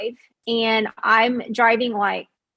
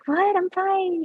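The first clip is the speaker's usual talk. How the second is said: much higher, incredulous "who, me?"; breezy retold denial; 9 semitones higher, slower than usual. Daughter brushing off her worried mom, high mock-innocent protest.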